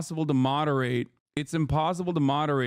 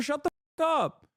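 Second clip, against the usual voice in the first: exasperated outburst; 11 semitones higher than usual. Real, not acted. Exasperated rant at chat.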